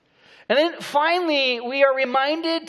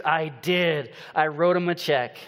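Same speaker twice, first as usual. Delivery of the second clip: smug wife punchline; 6 semitones lower than usual. Retold or imitated. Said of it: "She goes".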